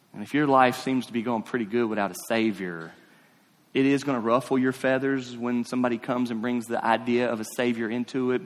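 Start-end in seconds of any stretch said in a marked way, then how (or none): none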